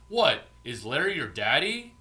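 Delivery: loud, high mocking jab; mocking taunt. Bar friend mocking a buyer, loud mock taunt. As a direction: sneer it out to needle him.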